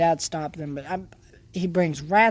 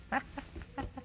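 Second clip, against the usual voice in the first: breathy burst of laughter; 9 semitones higher, 16 dB quieter than usual. Real, not acted. Laughing at own joke.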